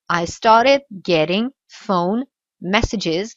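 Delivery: "I started getting phone messages" slow and careful; slow teaching pace. Shown as a demonstration. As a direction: spell it out slowly for learners. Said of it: "Donc ici".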